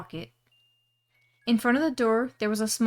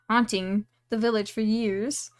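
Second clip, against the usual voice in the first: ordinary delivery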